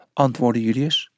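plain reading voice

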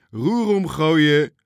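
loud, high, drawn-out yell; shouted instruction. A narrator's reading. Tjits shouts steering orders to Toontje, long loud yell. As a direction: stretch out the order so it carries over the sea. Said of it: "schreeuwde Tjits".

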